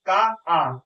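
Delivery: का then a long, open आ sounded on its own; drawn-out आ pulled from का; vowel sound split out. Shown as a demonstration. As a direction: pull the आ of का out and hold it. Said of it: "देखिए pronunciation किस तरीके से होता है".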